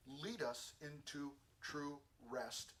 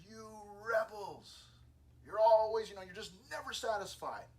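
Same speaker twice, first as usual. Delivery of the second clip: angry rebuke; 4 semitones higher, 7 dB louder than usual. Retold or imitated. Moses goes out to the rebels, angry rebuke. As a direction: act out Moses scolding the rebels.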